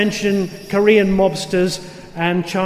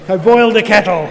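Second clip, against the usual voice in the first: louder put-on frog voice; 6 dB louder, faster than usual. A narrator's reading. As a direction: redo the line as the frog. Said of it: "I don't normally do a frog voice".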